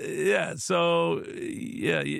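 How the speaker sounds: normal talking voice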